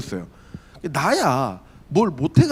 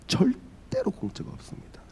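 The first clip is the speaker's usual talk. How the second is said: slow, breathy emphatic whisper; sermon whisper for emphasis; 8 semitones higher, 10 dB quieter than usual. Real, not acted. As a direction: lean in and whisper the point. Preacher drops to emphatic whisper.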